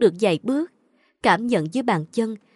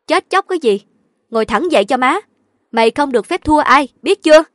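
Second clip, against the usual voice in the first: loud, raised, stern orders; scolding mother; 5 semitones higher, 8 dB louder, faster than usual. A narrator's reading. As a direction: scold the daughter forcefully. Mother sternly scolds her crying daughter. (mắng con lớn tiếng)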